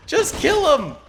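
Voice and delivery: shouting high and loud at the screen; frustrated shout. Real, not acted. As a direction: yell it in frustration. Yelling at his units.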